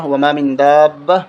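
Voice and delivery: Quranic verse recited slowly; slow recitation. Shown as a demonstration. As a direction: intone the verse slowly and evenly. Quotes verse opening.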